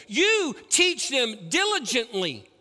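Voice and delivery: "You" punched, words slowed; stress on You. Real, not acted. Preacher charging parents, "You" stressed and slow. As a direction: hit "You" and slow "teach them diligently".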